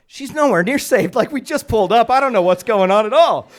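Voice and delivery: high, shouted confession to the audience; loud comic aside. Retold or imitated. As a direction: keep yelling through the aside. Aside kept at football-voice volume.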